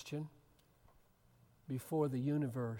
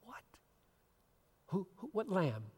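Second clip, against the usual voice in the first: puzzled angels; 6 semitones higher, 3 dB louder than usual. Retold or imitated. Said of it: "they say".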